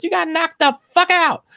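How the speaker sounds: high mock Friday line; movie quote impression. Friend quotes the movie Friday in a high put-on voice. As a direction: squeak out the Friday catchphrase high. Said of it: "where he goes".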